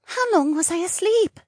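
puzzled and light; young mermaid voice. Acted.

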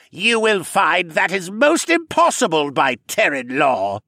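high, loud, smug sneer; twisted smirk. A narrator's reading. Senna gloating over Terran law, with a twisted smirk. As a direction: smirk your way through the legal point. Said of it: "Senna said, with a sick, twisted smirk".

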